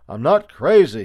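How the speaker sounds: loud, high protesting shout. A narrator's reading. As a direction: shout it to people outside. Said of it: "he shouted at the top of his mouth".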